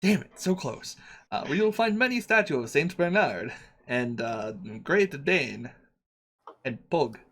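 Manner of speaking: lightly put-on broken-English shopkeeper accent; a hint of a foreign merchant haggling; vague foreign accent. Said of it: "what the fuck is this accent".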